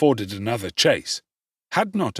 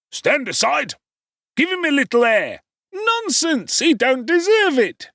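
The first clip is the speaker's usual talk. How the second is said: much higher, louder voices; crowd shouting; 4 dB louder than usual. Acted. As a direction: bark orders over the crowd. Crowd round fallen boy.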